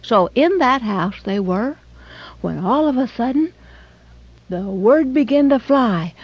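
plain reading voice

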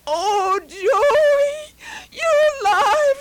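mother sobbing over her rescued son. A narrator's reading. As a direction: sob with joy and shock. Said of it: "she sobbed".

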